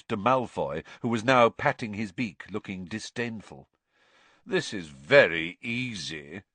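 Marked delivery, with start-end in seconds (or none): 4.5-6.4 slow, lower drawl; Malfoy's drawl. A narrator's reading. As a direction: drawl it out lazily. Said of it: "Malfoy drawled".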